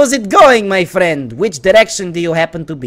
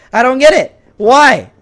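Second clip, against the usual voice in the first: higher and louder, baffled; louder exasperated repeat; 6 semitones higher, 7 dB louder, slower than usual. Real, not acted. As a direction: throw up your hands on it. Repeating in frustration.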